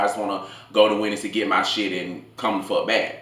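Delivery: ordinary delivery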